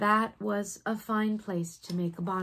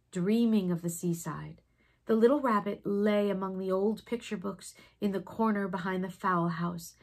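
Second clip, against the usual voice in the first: plain reading voice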